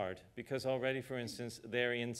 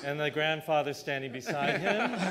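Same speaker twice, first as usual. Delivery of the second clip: laughing joke, louder; 5 semitones higher, 8 dB louder than usual. Real, not acted. Joking about older colleague.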